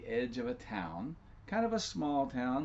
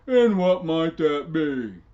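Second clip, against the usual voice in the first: very loud, slow, higher giant challenge; mocking giant; 5 semitones higher, 14 dB louder, slower than usual. Giant challenging Jenny's claim, loud and slow. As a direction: drag it out, daring her. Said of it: "said the giant".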